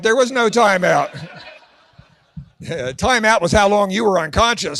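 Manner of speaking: usual speaking voice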